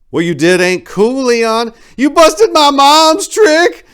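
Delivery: high, loud, enraged shout; raging shout. A narrator's reading. Son accusing the pimp, raging shout. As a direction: scream it in his face, losing control. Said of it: "Rio shouted".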